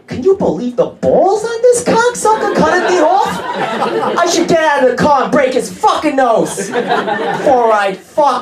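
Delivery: ranting at the cocksucker who cut him off; road rage rant. Retold or imitated. Comedian voicing his father yelling at another driver, hammed-up rage.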